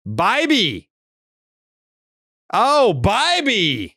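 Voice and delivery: high, drawn-out cry on the name; hammed-up delight at the name; playful exclamation. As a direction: crow the name high, stretching it out. Delighted riff on corrected name.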